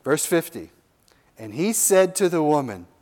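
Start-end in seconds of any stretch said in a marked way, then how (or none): none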